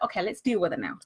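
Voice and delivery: calm self-talk after taking a breath; calm centered. Retold or imitated. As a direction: settle yourself after a deep breath. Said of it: "When you stop and you take a breath".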